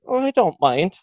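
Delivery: put-on high Wexford girl's voice; mock Wexford accent. Girl agreeing to a date, mock Wexford accent. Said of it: "they had that horrible accent".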